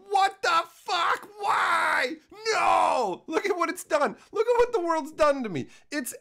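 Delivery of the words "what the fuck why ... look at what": much higher, loud, slow crying shout; hammed-up wail of outrage at everything; anguished outcry. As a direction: howl in despair at the world. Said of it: "staring at the world and going".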